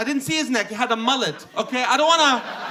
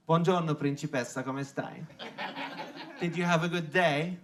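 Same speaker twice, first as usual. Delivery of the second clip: lower, smooth Italian charmer; Italian accent; 6 semitones lower, 4 dB quieter than usual. Retold or imitated. Italian guy flirting with an Australian woman, smooth accent. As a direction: woo her in a lilting Italian accent. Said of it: "they walk up to Australian women and they go".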